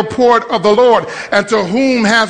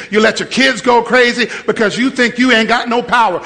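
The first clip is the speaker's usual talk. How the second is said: ordinary talk, nothing marked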